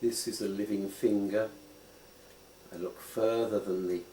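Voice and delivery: unhurried meditation guide; guided contemplation. Acted. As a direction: lead them gently into looking closely. Guides a slow finger contemplation.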